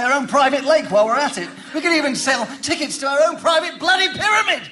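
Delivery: high, laughing half-shout at the absurd pyramid; mock-cheerful exasperated shout; sarcastic outburst. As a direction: build to an exasperated, laughing rant. Sarcastic rant about the sand.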